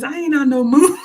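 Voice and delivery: high, loud playful yell; mock shout. Streamer shouting a viewer's joke, playfully loud. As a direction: belt it out as a joke.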